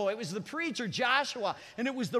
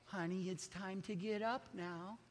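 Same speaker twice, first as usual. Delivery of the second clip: much softer, lower, tender murmur; gentle wake-up; 4 semitones lower, 14 dB quieter than usual. Pretend. Preacher mocks a gentle wake-up call, soft and tender. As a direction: coax a sleepy child awake. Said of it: "I'm not talking about this little".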